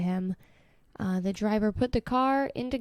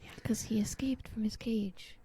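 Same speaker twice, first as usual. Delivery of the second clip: softer conspiratorial whisper; hushed telling; 9 dB quieter than usual. Real, not acted. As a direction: lean in and whisper the detail.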